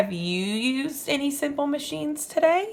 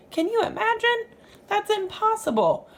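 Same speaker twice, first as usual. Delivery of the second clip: high, wide-eyed disbelief; playful astonished teacher voice, much higher; mock amazement; 11 semitones higher, faster than usual. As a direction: gasp at the idea like it's unthinkable. Teacher marvels to the children.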